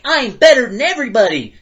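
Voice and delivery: high, smug voice; mock the stuck-up kid; snooty. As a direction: arrogant mock boast. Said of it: "people from being like".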